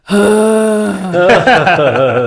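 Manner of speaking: loud, exaggerated frustrated sigh; mimicked exasperated sigh. Son mimicking mom's frustrated sigh, loud exaggerated. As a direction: ham up a frustrated parent's sigh. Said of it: "And he goes".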